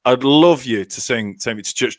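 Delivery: normal talking voice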